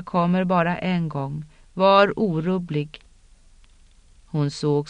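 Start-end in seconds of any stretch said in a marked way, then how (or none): 1.7-3.0 otherworldly command. A narrator's reading. Ritual voice commands her.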